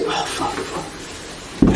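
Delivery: slow panicked whisper; hushed startled swearing; whispered curse. Retold or imitated. Waking up on phone.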